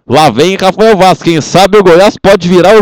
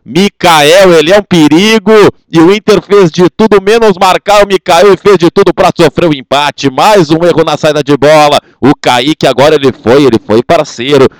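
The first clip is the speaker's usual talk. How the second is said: ordinary delivery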